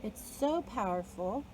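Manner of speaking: ordinary talk, nothing marked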